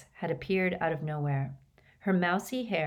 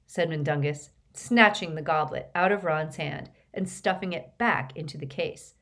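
ordinary delivery

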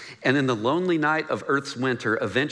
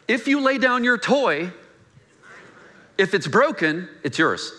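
put-on higher, slow childish voice; mock toddler logic; 7 semitones higher than usual. Pastor mimics a toddler's rules, higher and slow. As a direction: draw it out like a kid bargaining. Voicing a toddler's selfish claims.

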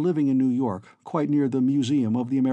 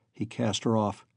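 usual speaking voice

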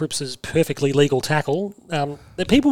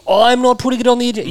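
raised, louder mock protest; mock media disclaimer; 8 semitones higher, 5 dB louder than usual. Host mocking media hypocrisy, exaggerated protest. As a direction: parody a pundit insisting, pitched up. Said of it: "They do this weird thing".

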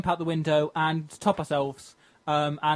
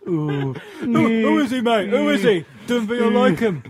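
cockney geezer; 4 semitones higher, 3 dB louder, slower than usual. Acted. Improvised cockney salesman.

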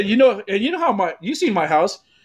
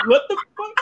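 mom's reaction; 13 semitones higher, faster than usual. Retold or imitated. Retold mom reacting.

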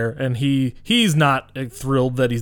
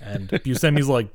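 normal talking voice

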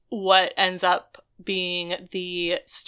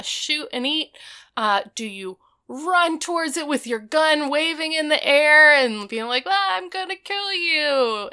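mocking [name]; 7 semitones higher than usual. [name] mocking Lonnie's hunting.

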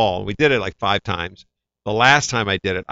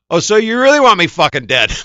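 louder, higher, joking indignation; mock outrage; 10 semitones higher, 9 dB louder than usual. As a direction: act shocked at the director's request. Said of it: "And I went".

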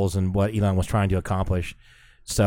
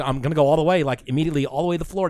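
pitched up high and racing on the second line; eager to floor it, voice climbing; excited; 6 semitones higher, much faster than usual. Retold or imitated. To his friend before flooring the Tesla, excited and high. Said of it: "I said".

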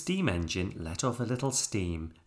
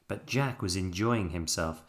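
ordinary delivery, nothing marked

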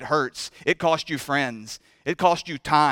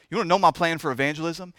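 ordinary talk

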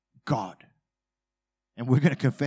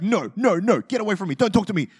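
higher, louder mock fury; mock angry push-away; 7 semitones higher, 5 dB louder, faster than usual. Spouse after a fight, hammed-up angry brush-off. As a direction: shove them off in hammed-up anger. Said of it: "all over your face, it's like".

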